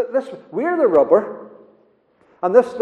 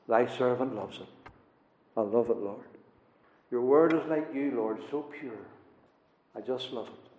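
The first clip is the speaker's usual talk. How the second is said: tender prayer; 7 semitones lower, 10 dB quieter than usual. Acted. Voicing David's loving prayer.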